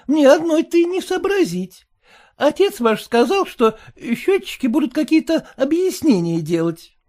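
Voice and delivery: high, sour, fretful wife voice; high complaining wife; sour merchant's wife. A narrator's reading. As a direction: whine it like a sour housewife. Mother voiced.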